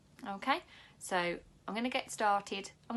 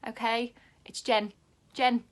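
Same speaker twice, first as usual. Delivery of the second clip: "Jen" hit loud with a falling tune; stress on Jen; 2 semitones higher, 10 dB louder than usual. Shown as a demonstration. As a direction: firmly correct the name, "Jen", "Jen". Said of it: "not Jenny".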